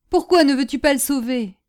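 high-pitched, heated demand; blazing cry. A narrator's reading. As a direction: cry out for an answer, ablaze. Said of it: "criait-elle d'une voix étincelante". (cri ardent, d'une voix aiguë)

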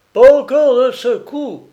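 high, loud fierce cry; wild shout. A narrator's reading. Old sailor shouting defiance at the shells, wild shout. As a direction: bellow it out savagely. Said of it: "clama sauvagement Salaun".

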